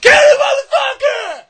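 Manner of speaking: high-pitched full-force scream; yelling. Acted. Librarian yelling at a fighter, full-throated screaming.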